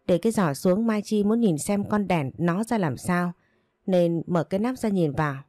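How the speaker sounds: usual speaking voice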